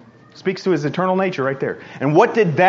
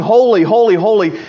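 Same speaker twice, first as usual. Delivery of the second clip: raised, louder call of praise; 3 semitones higher, 5 dB louder than usual. Acted. Preacher voicing the creatures calling to God, raised call. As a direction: call it out higher, easing on the last word. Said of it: "creatures calling".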